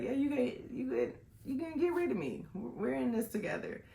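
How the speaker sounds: excited playful. Retold or imitated. Joking with her new friend.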